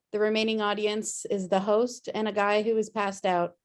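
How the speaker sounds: ordinary talk, nothing marked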